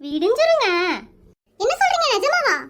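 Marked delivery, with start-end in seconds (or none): 1.6-2.5 high, stunned question; shocked disbelief. Acted. Interviewer reacting to the housewife's late dinner, stunned and high. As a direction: gasp it out like it's unthinkable.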